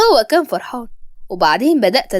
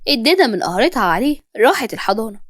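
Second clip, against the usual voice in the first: ordinary delivery, nothing marked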